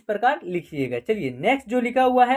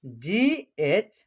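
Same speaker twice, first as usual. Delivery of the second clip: GH drawn out very slowly and lower; slow spelled answer; 4 semitones lower, much slower than usual. Shown as a demonstration. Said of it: "क्या होगा?".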